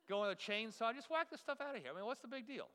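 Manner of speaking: high-pitched, dismissive confidence; cocky bravado. Retold or imitated. Pastor planning chainsaw demolition, cocky bravado. Acting out his cocky overconfidence.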